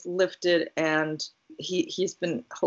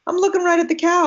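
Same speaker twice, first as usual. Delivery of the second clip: excited; 11 semitones higher, 7 dB louder, faster than usual. Real, not acted. Excited about the cow.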